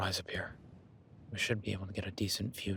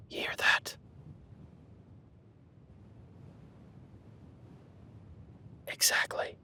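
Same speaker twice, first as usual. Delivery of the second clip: hushed, alarmed whispering; tense whisper. Acted. Hunter whispering that the creature knows they're here. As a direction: whisper as if it's listening.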